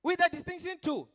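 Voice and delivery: high, loud proud announcement; boasting happy mother. Acted. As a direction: crow it so the neighbor hears. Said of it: "She was very happy".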